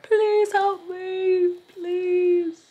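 high, loud, very slow begging plea; hammed-up plant begging for rescue; pleading sunflowers. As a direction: whine it out like a wilting flower begging for help. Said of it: "They're like".

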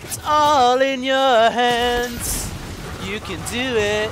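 slow, deliberate self encouragement; slow, drawn-out pep talk. Real, not acted. Gamer calming himself down, slow and drawn out. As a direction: stretch every word, calming yourself.

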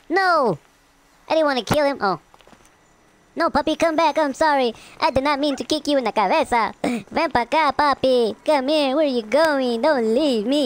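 high, anxious pleading to a dog; distressed pleading. Real, not acted. Player begging the puppy he kicked, high and pleading. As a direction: beg the puppy to come back.

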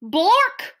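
loud, high comic blurt; abrupt, punched-out nonsense; nonsense word. Acted. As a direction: blurt it out like a silly noise.

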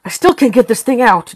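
fed up with the stuck lock; frustrated. A narrator's reading. John struggling with the lock, frustrated. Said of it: "John sighed in frustration".

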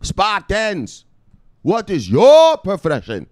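high, loud, slow battle call; rallying cry. Retold or imitated. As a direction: rouse the troops. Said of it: "And he said".

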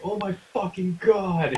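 drawn-out, high-pitched mock yell; retold yell. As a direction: re-enact yelling it, slow and high without real volume. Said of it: "yelled, like".

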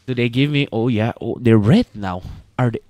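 ordinary delivery

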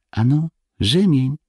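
put-on high, small-boy voice, timid; timid child. A narrator's reading. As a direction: answer father reluctantly in a high voice. Timid boys answer threatening father. (nieśmiała, dziecięca odpowiedź)

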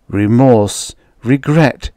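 normal talking voice

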